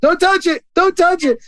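put-on high-pitched little kid protest; imitated hurt child. Little kids when they get hurt, high protesting voice.

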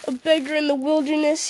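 high, scornful sneering echo; mock the boast with a sneer. A narrator's reading. Ruffian sneers at Frodo's news of his master, mocking. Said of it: "he mocked".

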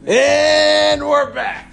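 high, slow, drawn-out announcer. Pretend. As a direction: stretch it out like a radio host. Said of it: "radio break return".

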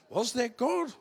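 high, loud question amid laughter; laughing disbelief. Real, not acted. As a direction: cry it out, cracking up. Joking doubt between laughs.

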